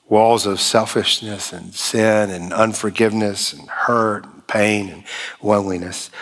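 ordinary delivery

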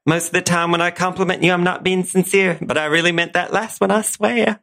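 high, coy belle backpedalling; Blanche Devereaux. Acted. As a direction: preen and then swear it's true. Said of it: "In the role of Blanche Devereaux".

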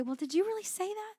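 high-pitched doubtful challenge; incredulous questioning. Acted. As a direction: ask it high, climbing in disbelief. Acting out doubting God's word.

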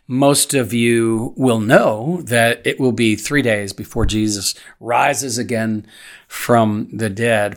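ordinary delivery

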